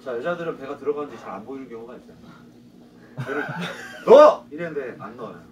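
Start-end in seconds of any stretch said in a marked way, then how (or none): 3.2-4.4 loud laughing command; laughing shout. Retold or imitated. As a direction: bark it out with a laugh. Retold shout at student, laughing.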